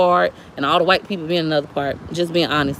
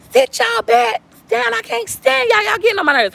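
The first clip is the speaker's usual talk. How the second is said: fed-up mom scolding, pitched way up; parent going off; 13 semitones higher than usual. Retold or imitated. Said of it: "flip out and go off on our kids".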